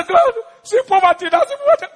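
high, loud mock prayer; mock desperate appeal. Preacher mocking those who beg God about poverty, high loud appeal. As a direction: cry it out as the wrong way to pray.

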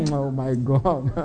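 soft, slow laughing oh my God; quiet giggling fit; real laughter. Real, not acted. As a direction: laugh it out helplessly. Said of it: "the way I'm laughing now".

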